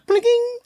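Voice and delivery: high-pitched phone ding; imitated text alert. Waiting on a text all day, imitated high alert. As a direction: chirp it like a message tone.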